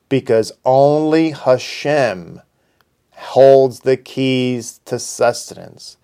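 slow, weighty stress on "only Hashem" and "holds the keys"; slow emphasis. Acted. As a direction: lay each word down slowly, stressing "only" and "holds". Preacher drives home the point.

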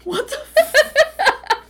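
slow, loud and high, breaking into laughter; laughing disbelief. Real, not acted. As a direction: cackle the words out in disbelief. Reacting to the absurd plot.

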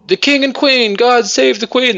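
high-pitched proclaiming cry; royal procession cry. Retold or imitated. Townsfolk saluting the passing king and queen, mock proclamation. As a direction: hail the royals for the whole town square.

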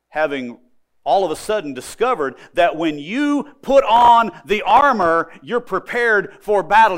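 loud, high pulpit delivery; fervent preaching. Acted. Preacher warns the congregation about the devil, fervent. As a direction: drive the point home.

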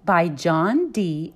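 ordinary delivery, nothing marked

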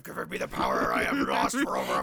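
deep demon roar. Acted. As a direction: boom like an ancient demon. Demon regains lost power.

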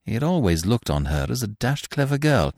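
ordinary delivery

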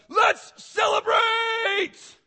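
huge, high, drawn-out celebratory shout; exultant shout. Acted. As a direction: bellow it out as God calling all heaven to party. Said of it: "God stands up on his throne and goes".

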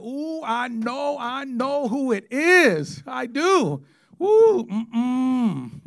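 high, slow singsong taunt at the detective; mocking taunt. Teenager taunting the detective, high drawn-out singsong. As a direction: tease him that you know, drawn out.

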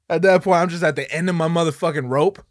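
usual speaking voice

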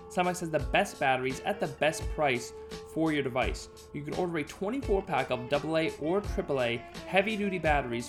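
upbeat sponsor read; hard sell ad. Acted. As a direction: read it like a radio ad.

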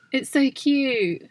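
ordinary talk, nothing marked

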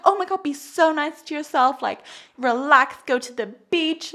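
high, sweet coaxing voice; gushing kind friend. Retold or imitated. Talking a stressed friend into the beach, high and sweet. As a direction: coo at a friend to relax. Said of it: "I'm like".

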